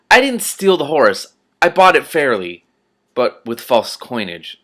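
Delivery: loud, slow, indignant denial; indignant protest. Acted. Rincewind protesting to the patrician, slow and loud. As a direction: shout the protest, stung by the charge.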